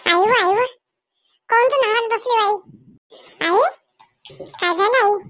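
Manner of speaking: put-on high little girl crying out for her mother; young girl's voice. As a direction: play the small daughter calling mama. Child figure Pinky cries for mother. (लहान मुलीचा उंच आवाज)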